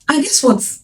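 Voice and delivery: ordinary talk, nothing marked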